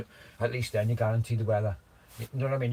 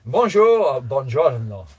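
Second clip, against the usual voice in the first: mock Italian accent; 8 dB louder, much slower than usual. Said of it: "Or Italian, Italian, not French".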